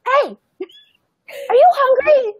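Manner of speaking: high, laughing, too-eager hello; overexcited greeting. Retold or imitated. Mother walking up to a young homeless man, high laughing overeager greeting. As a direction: greet him way too brightly, half laughing. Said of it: "my energy is like this".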